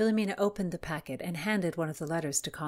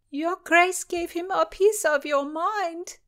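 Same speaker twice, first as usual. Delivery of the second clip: raised, slow, put-on lady's voice; high character voice; 13 semitones higher, 7 dB louder, slower than usual. Narrator voicing a lady praising her Grace, high and slow. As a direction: pitch it up high and take it slowly.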